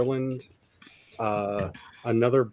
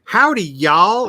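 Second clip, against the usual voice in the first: loud, drawn-out mock howdy; hammed-up outsider's howdy; mock Southern greeting; 7 semitones higher, 9 dB louder, slower than usual. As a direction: call out a big, slow, high-pitched Southern hello. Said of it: "and then they like".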